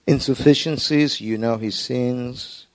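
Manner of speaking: soft, hushed pastoral prayer; murmured prayer over the congregation; quiet prayer. Acted. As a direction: pray it low and intimate before God. Pastor prays before the sermon.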